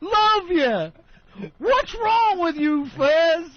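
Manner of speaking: high, slow sing-song fan voice; gushing fan. Retold or imitated. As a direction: gush over him like a starstruck fan. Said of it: "the guy next to us goes".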